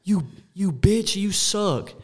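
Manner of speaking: high, slow jeering taunt; drawn-out mocking heckle; heckling fan. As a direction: jeer at him from the stands. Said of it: "some Oakland fan goes".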